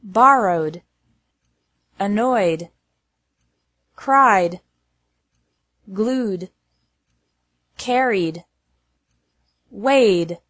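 "borrowed", "cried", "glued" with D straight after the vowel; careful word-list demo, "carried" closing on a simple D. Shown as a demonstration. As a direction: end "annoyed" and "weighed" on D, no extra syllable. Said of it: "E D is pronounced like D".

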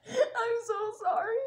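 high, squeaky apology through giggles; retold plea, breaking up with laughter; laughing apology. Patient apologizing to the nurse, retold laughing.

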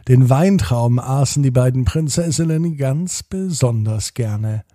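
plain reading voice

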